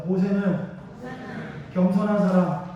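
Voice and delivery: loud, even call to the congregation; leading a repeat-after-me. Real, not acted. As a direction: project it firmly for everyone to repeat.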